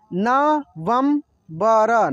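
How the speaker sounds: November drawn out slowly, syllables apart; slow syllable reading. Shown as a demonstration. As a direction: sound out November slowly for learners. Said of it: "जैसे जैसे मैं पढ़ रहा हूँ ना". (नवंबर को धीरे धीरे अक्षर अलग करके)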